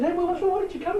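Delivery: high-pitched, worried call to a friend; imitating a surprised, concerned call. Retells asking a teammate.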